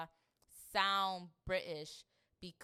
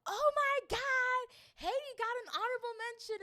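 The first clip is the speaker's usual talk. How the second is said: mock excited gush; 7 dB louder than usual. Mocking a fan thrilled by the film's Haiti mention. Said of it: "they're like".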